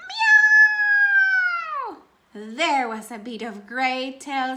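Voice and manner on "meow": very high, loud cat yowl; yelling meow. Acted. Kitten under the hat yowls when the potato hits, high and loud. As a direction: let the hat screech like a startled kitten.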